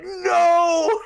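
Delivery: high, loud no with a crack of laughter; shouted no. Retold or imitated. Seeing Chase die, a loud shocked no. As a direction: yell no at the death. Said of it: "I was like".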